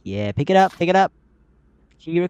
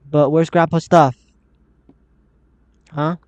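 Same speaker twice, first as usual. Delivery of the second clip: usual speaking voice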